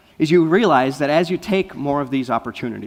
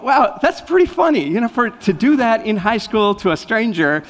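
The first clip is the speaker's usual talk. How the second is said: excited; 9 semitones higher, 6 dB louder than usual. Retold or imitated. Amused recounting of the girl.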